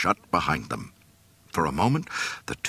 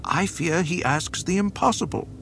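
strained, high worried voice; anxious imperial commander; nervous officer; 12 semitones higher than usual. A narrator's reading.

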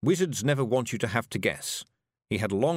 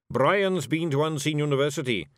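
smug, a touch louder; so-there smirk; 5 semitones higher, 4 dB louder than usual. Acted. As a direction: gloat with a smirk you can hear. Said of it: "with a so-there smirk".

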